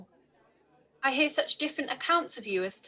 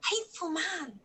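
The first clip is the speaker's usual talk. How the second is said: muttered aside; 3 semitones higher, 7 dB quieter than usual. Acted. Elizabeth to herself after accepting Darcy: muttered aside. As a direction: grumble it to yourself, unheard.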